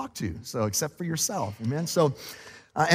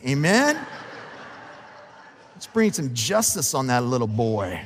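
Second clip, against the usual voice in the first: ordinary delivery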